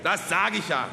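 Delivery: high, loud, emphatic agreement; triumphant agreement. A narrator's reading. Speaker concluding to the peasants, triumphant agreement. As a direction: pounce on it as vindication.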